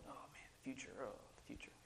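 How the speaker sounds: breathless whispered doom about the future; a hushed, mock-despairing groan; mock cynical gloom. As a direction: sigh it out like a jaded cynic. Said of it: "we wanna be cynical".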